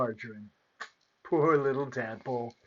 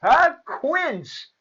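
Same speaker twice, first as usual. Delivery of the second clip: high, delighted exclamation; mishearing fairy; 10 semitones higher, 4 dB louder than usual. A narrator's reading. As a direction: seize on the wrong word triumphantly. Deaf fairy mishears happily.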